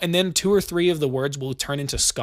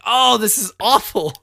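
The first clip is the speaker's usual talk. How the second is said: slow, louder, higher mock groan of dismay; 7 semitones higher, 6 dB louder, slower than usual. Griping about time zones, in a slow hammed-up dismay. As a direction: drag it out like a put-upon complaint. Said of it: "I'm like".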